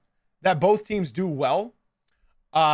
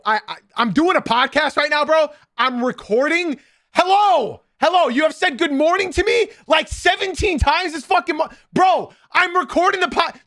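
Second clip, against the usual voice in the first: much higher and louder, fed-up shout; angry yelling; 10 semitones higher, 5 dB louder than usual. Real, not acted. Yelling at Adolfo for interrupting the podcast, real angry shout. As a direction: snap at the friend who keeps interrupting.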